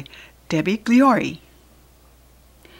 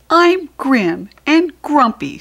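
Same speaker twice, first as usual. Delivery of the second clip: put-on high, loud sulky child voice; grumpy child; 8 semitones higher, 10 dB louder than usual. Small telling Large he is cross, a high sulky whine. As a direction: sulk it out like a cross little one. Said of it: "said Little Small".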